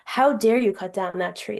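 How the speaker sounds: usual speaking voice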